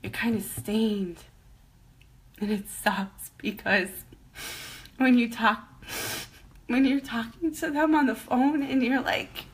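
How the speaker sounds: halting, tearful voice with long pauses; real crying. Real, not acted. Cancer survivor telling about insurance calls, crying. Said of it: "I'm sorry I'm crying".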